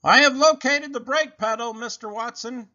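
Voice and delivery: put-on high, loud announcing child voice. A narrator's reading. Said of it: "Baby announced".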